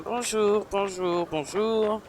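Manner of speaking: high, light, soft singsong; singsong greeting. Pretend. Gamer greeting villagers at the market, high singsong. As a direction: call out hellos in a playful high lilt.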